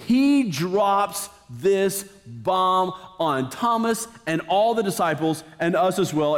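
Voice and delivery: ordinary talk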